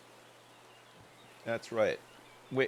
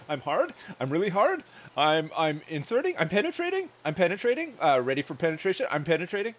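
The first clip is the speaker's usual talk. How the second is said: higher, louder callouts rising like questions; gamer voice; 6 semitones higher, 7 dB louder, faster than usual. Pretend. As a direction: bark it like a gamer on voice chat. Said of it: "In the gamer voice".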